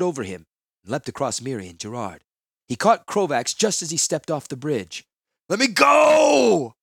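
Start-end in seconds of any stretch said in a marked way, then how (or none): 5.5-6.7 strained, much higher, slow, ragged scream; croaked shout. Acted. Krovax on the bridge edge, fighting Tahngarth's grip, croaking scream. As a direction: tear the words out as a hoarse croak. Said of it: "Krovax croaked".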